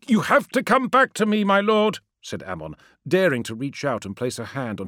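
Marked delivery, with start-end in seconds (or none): 0.1-2.0 high, urgent plea; earnest, distressed appeal; pleading. Acted. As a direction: beg a father to return. Ammon pleads with his father.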